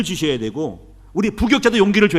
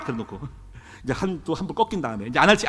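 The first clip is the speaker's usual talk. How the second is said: ordinary delivery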